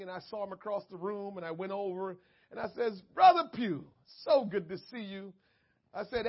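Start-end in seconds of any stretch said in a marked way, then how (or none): 3.1-5.3 hearty greeting. Retold or imitated. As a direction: call out his name warmly. Greeting a friend across room.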